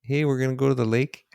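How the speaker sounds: lilting, lightly put-on Minnesotan; attempted Minnesota accent. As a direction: imitate a friendly Minnesotan, rising at the end. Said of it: "I can't even do the accent".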